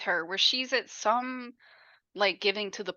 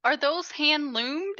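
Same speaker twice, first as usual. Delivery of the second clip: higher, slow, a touch of awkward politeness; awkward comment; 8 semitones higher, 4 dB louder, much slower than usual. Retold or imitated. Lady spotting Jimmy's blankets, awkward high-pitched question. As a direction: ask it slowly and pointedly, a little too sweet. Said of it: "she makes such an awkward comment".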